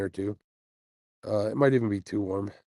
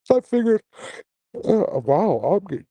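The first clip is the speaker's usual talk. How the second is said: yawning; 9 semitones higher, 6 dB louder than usual. Real, not acted. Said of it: "Sorry about yawning".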